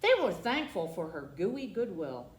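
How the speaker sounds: usual speaking voice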